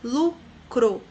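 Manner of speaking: LU - CRO in two separate beats; syllable by syllable. Shown as a demonstration. Teacher shows pupils the voice pulses, syllable by syllable. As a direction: say "Lucro" as two distinct voice pulses. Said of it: "identificamos pelo impulso de voz".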